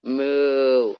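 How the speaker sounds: a mock cow's moo; hammed-up cartoon moo. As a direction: moo like a sponge pretending to be a cow. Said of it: "looks at her and goes".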